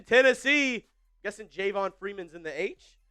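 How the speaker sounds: normal talking voice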